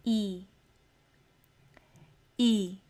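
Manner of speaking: ई drawn out as a long clear ee; long ee sound. Shown as a demonstration. Teacher stretching ई for her class. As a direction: hold ई as a long ee vowel. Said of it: "फिर है long ई का sound".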